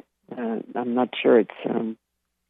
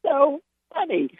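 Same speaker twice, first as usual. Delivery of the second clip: high, crying with laughter; imitating laughing friend; 9 semitones higher than usual. Retelling Alan's reaction, high and laughing. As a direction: quote him through tears of laughter. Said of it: "he was screaming".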